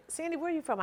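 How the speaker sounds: high, lilting quoted question; imitated questioner. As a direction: act out a curious acquaintance asking. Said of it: "when people say".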